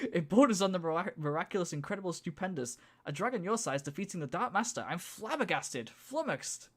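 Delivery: fast rattled-off words; machine gun delivery. Acted. Narrator voicing hyper Volteer, fast rattle. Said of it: "Machine gun deliver".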